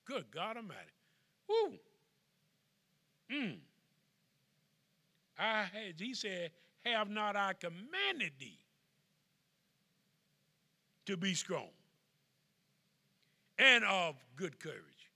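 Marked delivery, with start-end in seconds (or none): 1.5-1.8 excited whoop. Real, not acted. Preacher exclaims. 6.8-15.1 slow quoted command. Acted. Quoting God, building.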